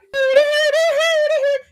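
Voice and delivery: shrieking burst of laughter; loud high cackle. Real, not acted. Cracking up at her own joke, high loud cackle.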